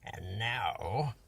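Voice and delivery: low, slyly grinning villain voice; a lightly voiced sneaky Grinch. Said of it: "grinned the Grinch".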